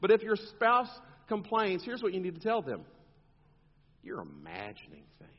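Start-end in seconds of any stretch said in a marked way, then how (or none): 4.0-5.3 low, hushed mock counsel; mock dismissive advice. Counselor's line to a complaining spouse, low mock dismissal. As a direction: drop it right down, as if gently dismissing them.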